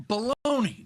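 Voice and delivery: scoffing dismissal. Real, not acted. Anchor waving off tech hype with a loud scoff. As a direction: throw the word away with contempt.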